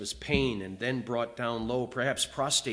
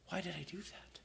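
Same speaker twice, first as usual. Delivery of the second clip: regretful whisper; 4 semitones higher, 13 dB quieter than usual. Retold or imitated. Preacher voices his own past regret.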